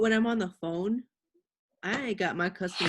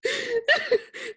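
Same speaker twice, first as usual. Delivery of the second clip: cackling laugh; high laugh. Real, not acted. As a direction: laugh out at the story. Laughing at nurse story.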